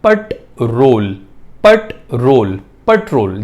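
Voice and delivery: पट रोल slow, with the stress on पट; पट रोल, clearly pronounced as a demo; correct pronunciation. Shown as a demonstration. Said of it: "इसकी सही pronunciation होती है पट रोल".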